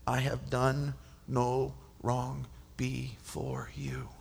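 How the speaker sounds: slow, softly fading plea of innocence; hushed, humble answer. Acted. Daniel answers the king, slowing into a whisper. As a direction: let it fall to a whisper.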